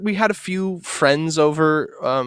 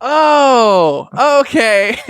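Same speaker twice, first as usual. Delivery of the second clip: surprised relief; 7 semitones higher, 8 dB louder, much slower than usual. Retold or imitated. Realizing it's a cake.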